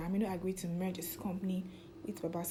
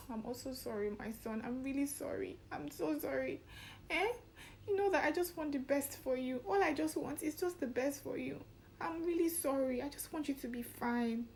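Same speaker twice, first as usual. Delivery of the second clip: higher, softer, a lightly voiced tender mother; mock-tender mother soothing her son, pitched up; apologetic mother; 7 semitones higher, 4 dB quieter than usual. Mother hugging her son, soft higher apology.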